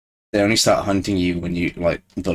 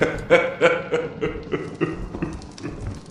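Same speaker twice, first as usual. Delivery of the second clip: breathy giggle; 10 semitones higher than usual. Real, not acted. Awkward about empty chat.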